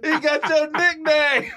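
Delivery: high, slow, laughing through words; cracking up mid-sentence. Real, not acted. Cracking up at friend's story.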